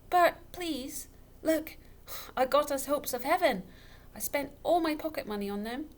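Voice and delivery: high, urgent pleading; girl begging Bert to stay. A narrator's reading. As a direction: coax him back gently but desperately.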